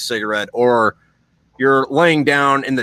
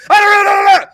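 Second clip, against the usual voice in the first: high, loud, repeated mock screams; imitated screaming; 11 dB louder than usual. Toddler scaring off a huge dog, imitated screaming. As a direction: scream like a little kid charging in. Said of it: "and starts screaming".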